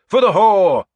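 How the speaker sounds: high, loud war cry; one last roar before contact; battle shout. A narrator's reading. Leader charging the shield wall, loud war cry. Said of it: "I shouted".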